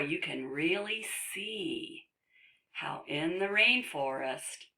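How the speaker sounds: ordinary delivery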